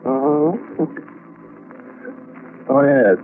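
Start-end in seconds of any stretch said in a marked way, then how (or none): none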